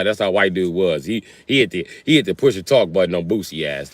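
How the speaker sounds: ordinary talk, nothing marked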